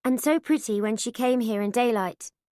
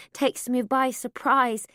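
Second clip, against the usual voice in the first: plain reading voice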